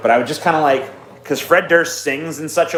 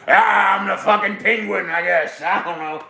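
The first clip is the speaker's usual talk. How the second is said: higher, half-hearted character squawk; put-on Penguin voice, losing conviction as it goes; Danny DeVito; 6 semitones higher than usual. Guessing at Danny DeVito's penguin, higher half-hearted impression. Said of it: "Danny DeVito".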